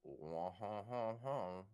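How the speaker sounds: a lazy, mocking "wah ha ha" laugh; mock carefree French laugh. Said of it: "It's kinda just like".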